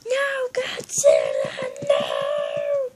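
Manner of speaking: high, slow, drawn-out wail; mock-dramatic plea in a squeaky voice; acted cry of alarm. Skit character to Godzilla, wailing high.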